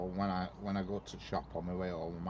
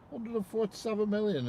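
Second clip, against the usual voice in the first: amazed; 14 semitones higher, 4 dB louder than usual. Real, not acted. Marvelling at the lottery win.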